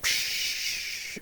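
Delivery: soft hissing static noise; a quick unvoiced psh like dead air. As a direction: breathe out a TV static hiss. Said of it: "there would be static".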